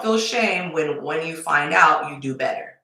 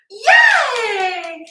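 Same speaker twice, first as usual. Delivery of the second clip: sarcastic shouted yay, much higher and louder; mock loud cheer; 8 dB louder than usual. Mocking a woman thrilled by scraps, loud sarcastic cheer. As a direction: cheer it like a tiny win is huge.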